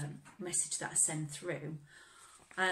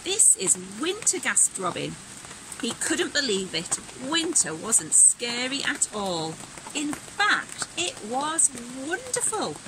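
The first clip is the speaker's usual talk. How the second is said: put-on bright, animated read-aloud for kids; lively storybook reading; 5 semitones higher, 9 dB louder than usual. A narrator's reading. Teacher reading Robin's winter story aloud, animated and bright. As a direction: act the story out with energy.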